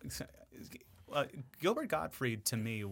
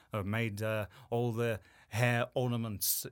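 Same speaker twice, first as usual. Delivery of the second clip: ordinary delivery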